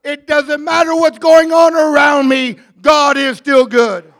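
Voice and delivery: loud, driven sermon shout; full-force preaching. Real, not acted.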